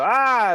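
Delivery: high, loud acted yelp; hammed-up cry as something lands; mock scream. Acting himself hit by the bag, high mock yell. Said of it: "and I go".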